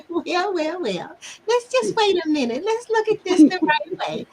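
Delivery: high, patient "let's wait" impression; high-pitched coworker voice. Older coworker calming her friend, imitated high-pitched voice. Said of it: "she had this high-pitched voice".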